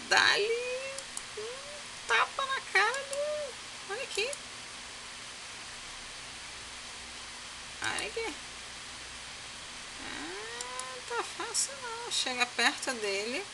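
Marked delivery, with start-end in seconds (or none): 0.1-3.9 high, slow, drawn-out squeal of shock; shocked slap reaction. Real, not acted. Watching the slap on screen, a high stretched shriek. 3.9-12.1 stunned reaction. Real, not acted. Reacting to the slap scene.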